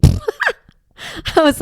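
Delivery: bursting into a high laugh mid-line. Real, not acted. Mother laughing at her son's hot tub plan, laughing. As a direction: laugh out loud, then start the reply.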